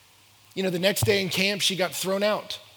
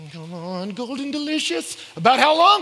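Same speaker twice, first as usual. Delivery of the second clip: mock exasperated grown-up; 7 semitones higher, 8 dB louder than usual. Mock adult pressing a child.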